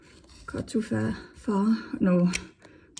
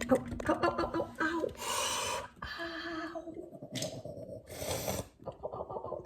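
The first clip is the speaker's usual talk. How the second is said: hushed, high-pitched string of ows; high, soft, stinging yelps of pain; real pain; 8 semitones higher, 9 dB quieter than usual. Real, not acted. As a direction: wince through repeated ows after banging your elbow. Just banged her elbow hard.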